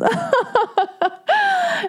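very high, exaggerated imitation laugh; hammed-up mocking titter, held long; mock derisive laugh. Musician laughing at her about turtles, mock derisive. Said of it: "he, like, laughed with her".

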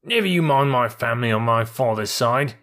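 ordinary delivery, nothing marked

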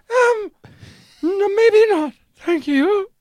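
very high, strained-polite falsetto, hammed up; awkward refusal. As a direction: back out in a squeaky mock-nervous voice. Awkwardly declining 'Africa' again.

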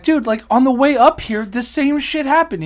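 high, loud retold protest; exasperated complaint. Traveler telling screener it happened before, exasperated. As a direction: insist on it, pitched well up.

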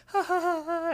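very high cartoon villain cackle; hammed-up maniacal laugh as the pie. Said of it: "starts to laugh maniacally".

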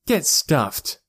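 contained yell of defiance; angry yell. A narrator's reading. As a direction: yell it as a brush-off. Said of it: "she yelled".

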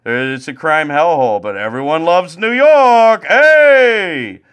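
building from light to a loud high cheery shout; mock New Yorker cheer. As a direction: ham up a proud New Yorker, ending in a yell. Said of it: "They're like".

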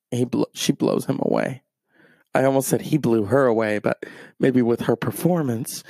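ordinary delivery

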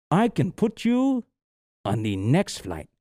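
put-on slow Mexican-accented English, pitch swinging; imitated Mexican accent. Huge airline manager offering a later flight, slow Mexican accent. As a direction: haltingly spell it out in a Mexican accent. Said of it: "the way you said that".

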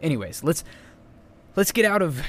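ordinary delivery

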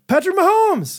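put-on high, excited little-kid cry of the name; mock child yelling a player's name; kid's excited shout. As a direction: squeal it like a thrilled young boy.

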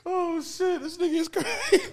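high-pitched laughing reaction; laughing disbelief. Real, not acted. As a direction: crack up in disbelief. Reacting to friend's joke.

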